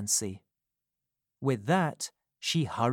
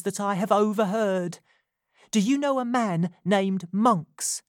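put-on higher, louder, urgent young woman; Nancy risking her life telling Rose the truth, urgent and louder; 9 semitones higher, 6 dB louder than usual. A narrator's reading. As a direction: confess it as if your life depends on it.

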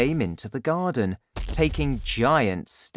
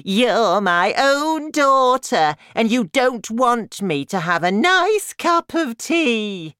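very loud, high granny wail; high, aggrieved old-woman tirade; Grandma, outraged; 11 semitones higher, 7 dB louder than usual. A narrator's reading. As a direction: guilt the daughter at full volume. Narrator voices Grandma.

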